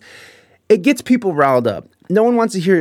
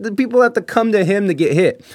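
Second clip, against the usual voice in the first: ordinary talk, nothing marked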